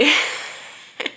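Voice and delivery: sudden high laugh; burst of laughter. Real, not acted. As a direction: laugh out loud at the plot. Laughing at the story.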